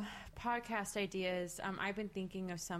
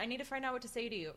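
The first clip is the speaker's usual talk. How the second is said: ordinary delivery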